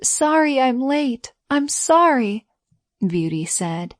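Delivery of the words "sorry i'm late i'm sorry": high, pleading apology; distressed apology. A narrator's reading. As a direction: plead with him. Beauty apologizing to dying beast.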